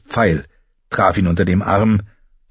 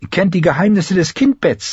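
handmaid Iri; 10 semitones higher than usual. A narrator's reading. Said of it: "sagte Iri".

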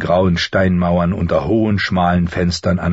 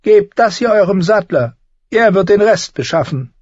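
higher, sly and needling; a higher, sardonic little man's voice; mocking dwarf Tyrion; 5 dB louder than usual. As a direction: talk down to a would-be lord with cool mockery.

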